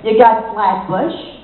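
ordinary talk, nothing marked

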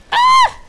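piercingly high, loud squeal of delight; excited squeal. Real, not acted. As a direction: let out a loud, thrilled shriek. Squeals over the gun.

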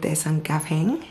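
lip held down by finger; talking with finger at mouth. Real, not acted. Seen 0: Fingertip presses lower lip down, showing teeth, while she speaks.

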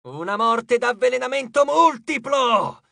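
high, slow shout; screamed threat. A narrator's reading. Furious goddess conjures poison against Percy, screaming. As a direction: shout it like a villain's curse. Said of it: "urlò".

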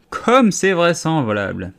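deeply contemptuous. A narrator's reading. Pigeon mocking Alice's claim, contemptuous sneer. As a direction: drip scorn on every word. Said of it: "d'un ton profondément méprisant".

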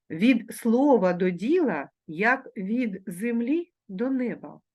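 slow, deliberate reading; slow careful reading; slow proverb reading. Shown as a demonstration. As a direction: read slowly so the class can repeat. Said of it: "вимовляючи дзвінки приголосні ... дзвінко".

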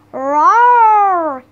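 loud, drawn-out storybook roar; gorilla roar. A narrator's reading. Giant gorilla gone crazy at Jack, roaring. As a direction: roar the word out long and loud. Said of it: "he roared".

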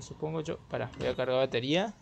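normal talking voice

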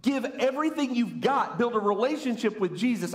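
high, urgent preacher's exhortation; imagined shout. Pretend. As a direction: call it out as a message from above. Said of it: "would just shout to us".

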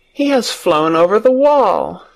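understated excited cry. A narrator's reading. Mary watches the robin fly off, crying out. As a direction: call it out while watching closely. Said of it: "Mary cried out, watching him".

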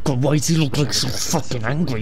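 normal talking voice

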